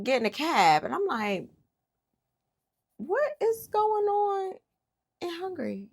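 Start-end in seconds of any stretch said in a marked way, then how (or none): 3.0-5.9 high, slow and drawn-out disbelief; high incredulous wail at the screen; exasperated disbelief. Real, not acted. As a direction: stretch it out, baffled and fed up. Exasperated at TV race moment.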